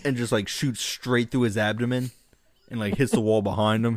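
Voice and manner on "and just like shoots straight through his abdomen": ordinary talk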